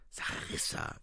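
urgent whisper of her name. A narrator's reading. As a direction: whisper it to get her attention. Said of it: "flüsterte William". (geflüstert)

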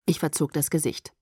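usual speaking voice

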